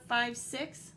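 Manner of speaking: plain reading voice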